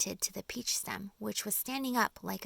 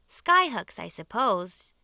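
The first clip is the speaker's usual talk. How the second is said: jeering; 11 dB louder, slower than usual. A narrator's reading. Centipede mocking James's plan with a jeer. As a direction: sneer at the idea. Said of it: "jeered the centipede".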